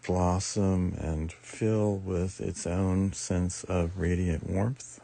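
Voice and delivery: usual speaking voice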